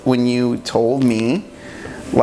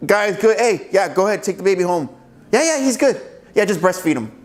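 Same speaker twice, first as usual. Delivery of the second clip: higher-pitched, casual doctor giving the all-clear; breezy doctor; 5 semitones higher than usual. Acted. Doctor sending parents home with a baby boy, breezy. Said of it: "the doctor's like".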